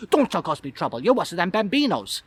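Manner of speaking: displeased Italian waiter; Italian accent. Acted. Italian waiter scolding a guest who fell, accented. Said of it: "but he isn't happy".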